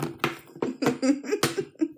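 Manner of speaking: high run of giggles; real giggle. Real, not acted. Laughing at her own tax joke, high giggle. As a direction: let a giggle roll out.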